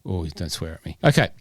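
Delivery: usual speaking voice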